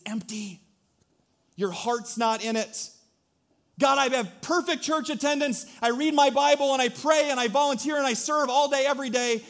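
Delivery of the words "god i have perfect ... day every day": mock boastful believer. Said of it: "believer pleading to God".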